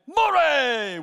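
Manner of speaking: high, loud drawn-out cry; shouted insult. Shown as a demonstration. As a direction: hurl it at someone as an insult. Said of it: "Both words were shouts at others".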